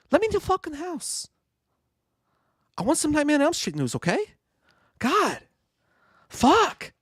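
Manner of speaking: high, worked-up rant in a tough-guy voice; Mark Wahlberg impression. Impression for the stream, a high frantic rant. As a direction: keep ranting, fired up and petulant. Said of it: "do Mark Wahlberg talking about no Nightmare on Elm Street news".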